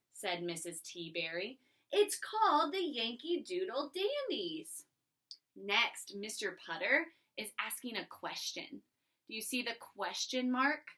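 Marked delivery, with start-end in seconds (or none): 1.9-4.9 slow, proud and eager; excited. Shown as a demonstration. Missus T Berry telling Mister Putter the team, excited. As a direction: announce the team name with delight. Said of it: "say this with excitement".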